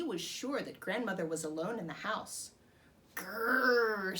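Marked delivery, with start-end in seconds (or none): 3.1-4.2 playful read-aloud growl; a hammed-up wolf growl for kids; wolf snarl. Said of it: "snarled the wolf".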